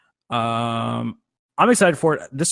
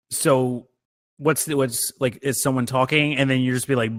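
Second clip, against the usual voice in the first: usual speaking voice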